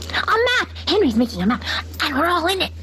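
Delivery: high, eager chicken cries; excited exclaim. A narrator's reading. Chickens exclaim about Henry's map, high and excited. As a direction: hens in an uproar. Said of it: "they exclaimed".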